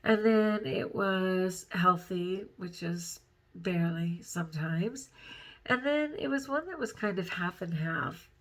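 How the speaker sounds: ordinary delivery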